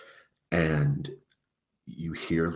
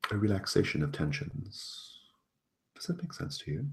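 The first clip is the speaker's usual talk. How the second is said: persuasive fae prince; 2 semitones higher, slower than usual. Acted.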